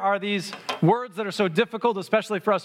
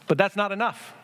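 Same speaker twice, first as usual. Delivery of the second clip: usual speaking voice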